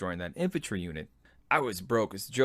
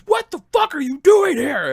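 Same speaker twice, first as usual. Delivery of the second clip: high-pitched, full-volume outraged shout; sergeant major yell; 13 dB louder than usual. Acted. Sergeant major confronts soldier in the barracks, furious yell. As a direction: bellow it at a knucklehead out of place. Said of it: "yell at the knuckleheads".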